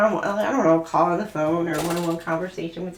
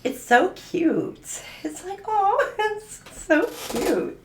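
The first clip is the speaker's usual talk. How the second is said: gushing; 9 semitones higher, slower than usual. Real, not acted. Gushing over a cute reading.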